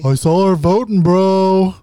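loud, high-pitched mock accusation; Josh impression. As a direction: call it out high and loud as an impersonation. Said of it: "Uh-oh, Josh impression".

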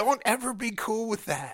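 outraged plea. Retold or imitated. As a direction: insist with mock horror.

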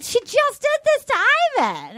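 high-pitched disbelief, dropping on Ivan; shocked realization. Retold or imitated. Viewer realizing what was done to Ivan, shocked. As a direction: jump high in mock shock. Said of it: "And I'm thinking".

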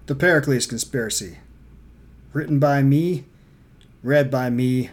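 announcer-style title read; title announcement. Acted. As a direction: announce the book grandly.

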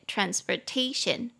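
transportation with TA punched; stress on third syllable. Shown as a demonstration. Teacher shows where the stress falls, transportation stressed on TA. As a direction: lean on the "ta" in transportation. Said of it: "重音节在第三音节".

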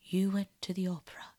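ordinary delivery, nothing marked

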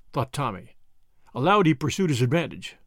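ordinary delivery

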